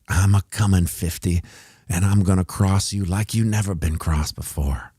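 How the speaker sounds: hammed-up Southern villain, not quite landed; mock Southern accent. Streamer voicing the fifty moving average in a loose Southern accent. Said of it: "I'm not sure what accent that is. Maybe it's a Southern accent".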